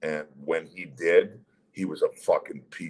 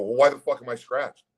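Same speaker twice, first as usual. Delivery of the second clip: higher, louder exasperated question; retold indignant challenge; 5 semitones higher, 6 dB louder than usual. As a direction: push back at the coach, re-enacted. Retold argument with assistant coach.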